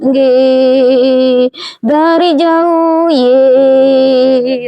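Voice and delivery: mock-refined drawn-out singsong; gentle Javanese palace courtier. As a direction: stretch it out slowly, sweetly parodying palace manners. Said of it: "orang Jawa lah yang lemah lembut".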